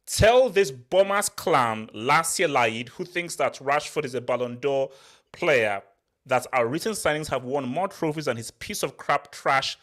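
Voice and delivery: usual speaking voice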